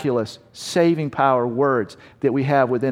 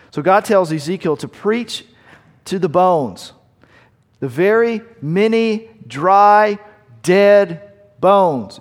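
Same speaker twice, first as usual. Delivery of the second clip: rising preacher's build, slow and louder on the dry, dead bones; preaching at full force; 4 semitones higher, 4 dB louder, slower than usual. Acted. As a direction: hammer each word of the bones home from the pulpit.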